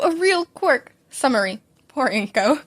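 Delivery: ordinary delivery, nothing marked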